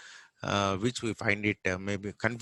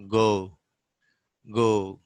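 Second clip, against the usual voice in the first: ordinary talk, nothing marked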